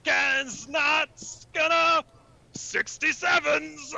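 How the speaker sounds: garbled mock-Cockney gibberish; incomprehensibly thick Cockney. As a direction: mangle every word in overdone Cockney. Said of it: "so thick we don't know what you're saying".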